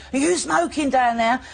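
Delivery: high, loud call from upstairs, drawn out; shouting down. Retold or imitated. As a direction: yell it down from the balcony, slowly. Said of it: "they'd be shouting down".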